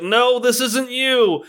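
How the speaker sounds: slow, high, heroic plea; calm the beast down, slowly; dramatic talk down. Retold or imitated. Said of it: "the really dramatic talk down".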